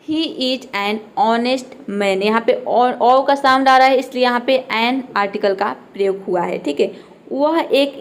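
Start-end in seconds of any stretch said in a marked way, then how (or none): 0.0-2.2 "He is an honest man" said very slowly, word by word; slow example sentence. Shown as a demonstration. Teacher slowly modelling an example with "honest". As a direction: drag out "He is an honest man" for learners. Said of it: "honest का मतलब".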